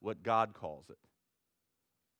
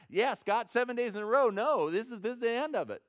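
louder, higher fed-up God ending it; mock impatient God; 10 semitones higher, 6 dB louder than usual. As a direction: genervter Gott, lauter und höher. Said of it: "the way God thinks is gonna be similar to mine".

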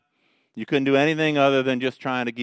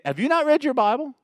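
much higher, rising on "not" in disbelief; mock-amazed rebuke; incredulous challenge; 12 semitones higher, faster than usual. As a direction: ask "Have you not read your Bible?" with "not" lifted high and rising. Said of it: "So he's saying".

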